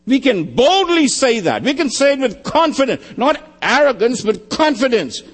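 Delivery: high, ringing preaching; fervent pulpit proclamation; bold declaration. Real, not acted. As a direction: proclaim it with confidence.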